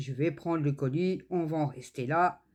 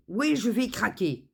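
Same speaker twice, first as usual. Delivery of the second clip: imitating the courier; 6 semitones higher, 3 dB louder than usual. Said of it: "il me dit".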